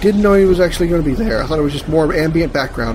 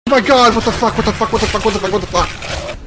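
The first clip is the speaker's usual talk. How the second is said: high startled cursing that fades; shocked, repeating it breathlessly; panicked swearing; 7 semitones higher than usual. Real, not acted. Jump scare in game.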